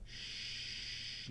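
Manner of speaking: "sh-" hushed with no voice; isolated hushed "sh-"; unvoiced sh sound. Shown as a demonstration. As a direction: hold a breathy voiceless "sh-". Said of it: "The ending sound being sh".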